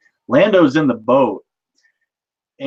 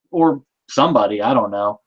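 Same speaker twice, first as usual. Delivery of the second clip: ordinary talk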